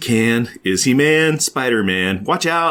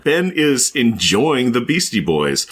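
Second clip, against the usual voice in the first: ordinary talk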